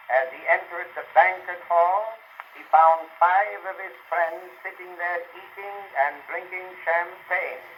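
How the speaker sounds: ordinary talk, nothing marked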